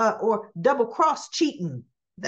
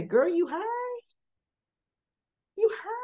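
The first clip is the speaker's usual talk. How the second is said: high, teasing "you high?"; mock incredulous; 11 semitones higher than usual. Teasing a giddy friend, high mock disbelief. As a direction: squeal it up in disbelief. Mocking Sasha's giddiness.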